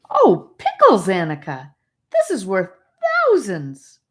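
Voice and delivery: put-on boy amazed at a precious gem, loud and thrilled. A narrator's reading. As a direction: blurt it out in delight.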